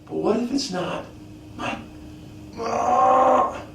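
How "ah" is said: a sharp, high strained "ah" shouted out; mock straining cry. Preacher acts out striving for a miracle, straining cry. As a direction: cry out like someone pushing to earn it.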